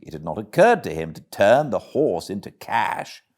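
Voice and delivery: ordinary delivery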